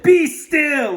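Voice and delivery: high, loud "Be still!" shouted as Max; commanding shout. Acted. Mother voicing Max taming the monsters, loud commanding shout. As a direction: bark it out like a little king. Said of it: "Till Max said".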